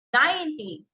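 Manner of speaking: "ninety" high on NINE, falling on ty; stress on first syllable. Shown as a demonstration. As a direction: punch the start of "ninety". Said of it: "so I gave the stress now on the first".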